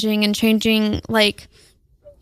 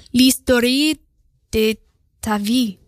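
French accent. Shown as a demonstration. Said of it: "I'm trying to do a French accent".

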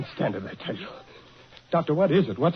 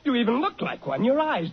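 ordinary delivery

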